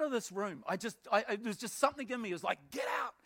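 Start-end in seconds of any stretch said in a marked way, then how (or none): 2.7-3.1 sudden high-pitched cry to flee; mock-panicked yelp, pitched far up; urgent command. Man's instinct ordering him out of the room, urgent command.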